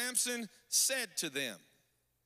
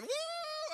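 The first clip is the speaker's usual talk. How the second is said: very high, sustained "woo"; imitated women's scream. Preacher mimicking five thousand women, high mock scream. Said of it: "five thousand women start screaming".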